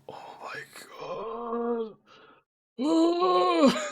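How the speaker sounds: hushed, despairing gasp rising to a high moan; upset whisper. Retold or imitated. Streamer realising they are late for the appointment, upset whisper. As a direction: retell the sinking realisation in a whisper. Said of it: "I was so upset".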